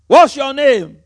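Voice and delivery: mock interrogation. Preacher mocking exorcists who question demons: loud demand. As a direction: bark the question like a showy exorcist.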